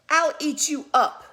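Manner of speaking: mock-defiant storybook outburst; defiant boy's threat. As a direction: throw it out like a cheeky little boy. Voices Max threatening his mother.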